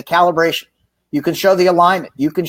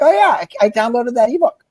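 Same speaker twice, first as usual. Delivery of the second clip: eager customer; 7 semitones higher than usual. Retold or imitated. Customer voiced in retold call.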